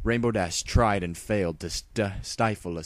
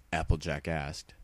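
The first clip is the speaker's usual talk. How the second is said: plain reading voice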